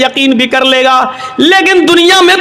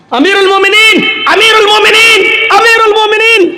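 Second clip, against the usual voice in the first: calling out; 6 semitones higher than usual. Acted. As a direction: call across the distance to the caliph. Slave calling out to Umar.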